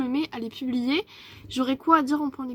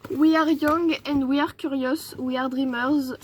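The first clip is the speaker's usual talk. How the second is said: slow English in a thick French accent; deliberately bad French accent; 4 dB louder, slower than usual. Shown as a demonstration. Reading a notebook's English motto to viewers, deliberately bad French accent. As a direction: read English with a crappy French accent on purpose. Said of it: "j'ai fait exprès de prendre un accent un peu de merde".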